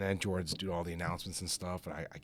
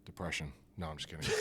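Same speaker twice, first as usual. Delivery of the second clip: ordinary talk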